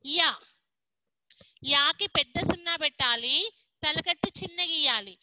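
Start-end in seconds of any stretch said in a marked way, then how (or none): none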